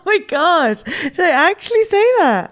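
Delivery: high laughing oh my God; laughing disbelief. Real, not acted. Laughing shock at quote.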